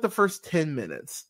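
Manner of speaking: ordinary delivery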